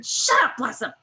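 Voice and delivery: high loud mock shout; yelling from a tent; retold yell. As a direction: bark it across the campsite. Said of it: "I keep hearing".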